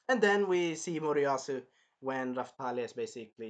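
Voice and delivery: normal talking voice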